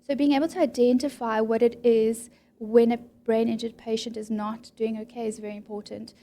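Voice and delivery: ordinary delivery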